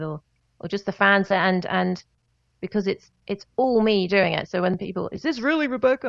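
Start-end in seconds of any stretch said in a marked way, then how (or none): 5.1-6.1 high-pitched American fan impression; put-on American accent, incredulous question. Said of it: "I keep doing American accent".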